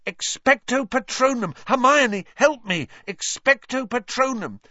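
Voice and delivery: high, urgent cries; panicked, high-pitched calling out; desperate plea. A narrator's reading. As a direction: cry the spell and beg for help. Casting spell, begging for help.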